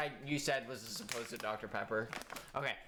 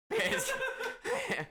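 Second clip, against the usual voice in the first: high laugh. Real, not acted. Laughs at own joke.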